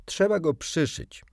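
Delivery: high, loud know-it-all pronouncement; somewhat superior. A narrator's reading. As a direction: say it as if you know better. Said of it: "powiedziała z niejaką wyższością".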